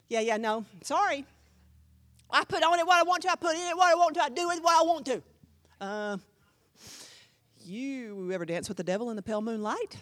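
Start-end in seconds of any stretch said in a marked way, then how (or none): 2.3-5.2 higher, louder, mocking a stubborn believer; mock defiant voice. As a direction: sneer it like a self-willed churchgoer.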